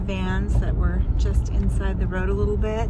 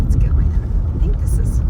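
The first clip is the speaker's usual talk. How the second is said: hushed whisper; 9 semitones higher, 4 dB louder than usual. Real, not acted. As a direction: whisper as you sneak in. Sneaking into meetup.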